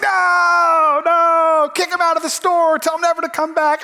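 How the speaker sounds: outraged shout. Acted. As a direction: shout it like a furious customer demanding the manager act. Jonah's outraged reaction to mercy.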